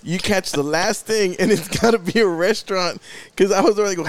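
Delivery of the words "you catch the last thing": ordinary talk, nothing marked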